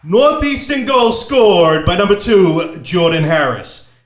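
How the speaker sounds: loud, slow goal call; excited PA announcer. Shown as a demonstration. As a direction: punch out every name for the home crowd. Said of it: "you really punched that shit through".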